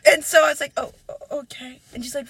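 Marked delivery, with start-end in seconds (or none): 0.8-1.8 very soft, faltering murmur; hushed stammer, almost no voice; meek retold reply. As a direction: imitate a crushed, tiny acceptance.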